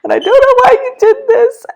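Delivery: very high, loud anguished protest; retold tearful outcry. Retelling her crying prayer to God, anguished. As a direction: imitate a distraught wail of a question. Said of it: "I remember just crying".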